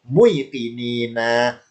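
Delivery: MUYAKININA said as "mui-kinina", slow; original form. Shown as a demonstration. Teacher gives the form before wawu replaces it, slow "mui". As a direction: draw out the "mui" glide in MUYAKININA. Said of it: "oleh Allah diganti wawu".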